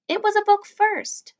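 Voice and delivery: put-on high, slow girl's voice; indignant girl. A narrator's reading. Summer correcting Augie about the book, high indignant voice. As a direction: set him straight, high and drawn out.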